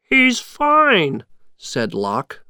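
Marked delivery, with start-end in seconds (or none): 0.0-1.2 high, cheeky little henchman voice; excited henchman. A narrator's reading. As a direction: call it out brightly, pitched high. Lock calls out on finding Jack.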